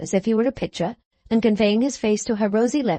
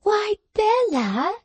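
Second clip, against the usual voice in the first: high, slow, drawn-out cry of surprise; astonished exclaim; 12 semitones higher, much slower than usual. A narrator's reading. Lavvy stares at Bella's dress, astonished. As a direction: stretch out the astonishment. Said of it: "exclaiming again".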